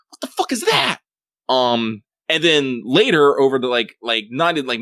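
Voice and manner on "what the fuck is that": a shocked yelp at something in the sky; alarmed character shout about a strange sight; high startled cry. Retold or imitated. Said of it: "it's all like".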